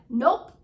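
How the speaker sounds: "Nope" loud with a quick lift; exclamation point on NOPE. Shown as a demonstration. Coach showing a pattern interrupt, emphatic "Nope". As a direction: punch "Nope" sharply upward. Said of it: "exclamation point down on that word".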